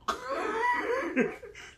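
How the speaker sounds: high imitated laughter; table bursting out laughing. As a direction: act out a burst of group laughter. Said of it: "whole table just bust out laughing".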